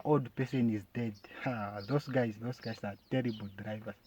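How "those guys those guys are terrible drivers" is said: ordinary talk, nothing marked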